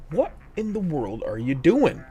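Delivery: put-on high, loud disbelieving shout at the kid; exasperated yell. Parent yelling at son jamming rocks in the keyhole, exasperated shout. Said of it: "I'm like".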